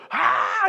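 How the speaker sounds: high comic cry of pain; mock pained scream. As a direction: cry out as if burning from tear gas. Imitates tear-gas agony.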